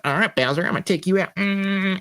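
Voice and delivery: put-on high, feisty child voice; mock battle resolve in a little voice; determined kid. Kid taking on Bowser again, playful defiance. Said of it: "But then you're like".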